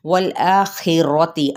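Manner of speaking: वल आखिरती very slowly, with the alif stretched; slow model recitation of वल आखिरती; Quranic recitation. Shown as a demonstration. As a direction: recite वल आखिरती, drawing out the long aa. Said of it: "मद्दे इसलिए बिल अलिफ".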